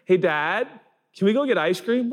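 put-on high-pitched hopeful kid; childlike, lilting request; little boy asking. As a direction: ask Dad for a treat like a small child. Small son asks dad for treat.

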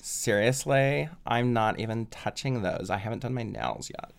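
lightly voiced California Karen, bored and pampered; California surfer girl accent. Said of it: "my only accent for Karens is, uh, like, like California surfer girl".

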